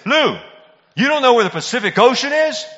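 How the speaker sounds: loud, high, outraged disbelief; incredulous teacher yell. Acted. History teacher to a clueless pupil, incredulous shout. Imagines confronting pupil Lou in class.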